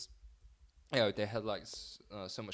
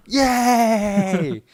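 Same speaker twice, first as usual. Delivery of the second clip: excited cheer; 10 semitones higher, 19 dB louder than usual. Real, not acted. Celebrating episode three start.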